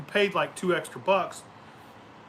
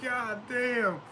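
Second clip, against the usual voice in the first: mock disgusted victim; 8 semitones higher, 3 dB quieter than usual.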